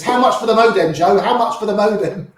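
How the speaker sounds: imitating an eager buyer, high and urgent; urgent pleading. Friend running after him to buy the modem, urgent pleading. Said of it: "Ram comes running after me".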